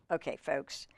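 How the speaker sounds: plain reading voice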